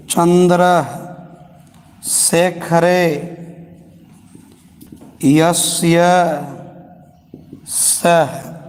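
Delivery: long pauses between चंद्र, चंद्रशेखरे, यस्य, सह; teacher breaking the phrase into pieces; word by word. Shown as a demonstration. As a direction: dictate each word separately. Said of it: "बस इधर थोड़ा सा ध्यान देना है".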